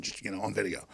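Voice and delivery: ordinary talk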